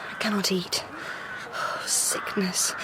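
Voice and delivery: a faint, worn-out whisper; barely able to get the words out; weak whisper. Acted. Valeria weakly admits illness.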